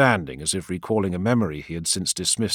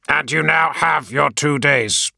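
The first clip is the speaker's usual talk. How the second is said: slow, loud and higher, savouring the win; triumphant announcement; 8 semitones higher, 6 dB louder, slower than usual. A narrator's reading. War leader announcing to his friend, triumphantly slow and loud. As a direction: announce it like a victory. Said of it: "he announced triumphantly".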